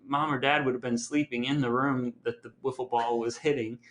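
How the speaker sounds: ordinary talk, nothing marked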